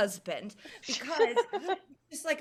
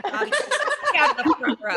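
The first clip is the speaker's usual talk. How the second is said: grandma scolding; 10 semitones higher, 9 dB louder, much faster than usual. Retold or imitated. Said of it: "She's like".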